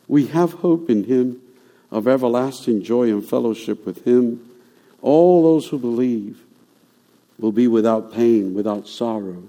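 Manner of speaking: usual speaking voice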